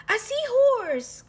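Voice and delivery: overjoyed cat calling out; excited cry. Acted. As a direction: shout with delight at the discovery. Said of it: "cries Pete".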